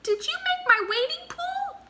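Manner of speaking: very high wondering squeak; squeaky mouse. A narrator's reading. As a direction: ask in a tiny amazed voice. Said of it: "asked Mouse".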